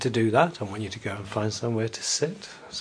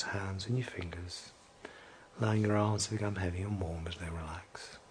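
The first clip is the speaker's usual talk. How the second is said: whispering; 3 semitones lower, 6 dB quieter than usual. Acted. Relaxation induction, whispered.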